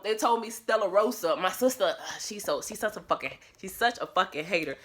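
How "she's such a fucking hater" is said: ordinary delivery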